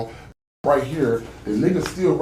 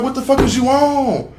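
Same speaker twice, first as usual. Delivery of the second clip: high, loud outraged shout; retold yelling; 10 semitones higher, 4 dB louder than usual. Speaker yelling back at the gunman in the retold fight, loud outrage. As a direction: yell it back in disbelief, retelling.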